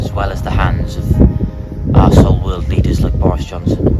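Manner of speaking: loud, rousing speech to the nation; rally them like a leader; impassioned speech. Acted.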